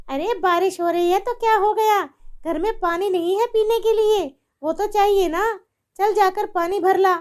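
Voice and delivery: loud, high harsh scolding; wicked mother-in-law. Acted. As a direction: bark the order at her. Mother-in-law shouts orders.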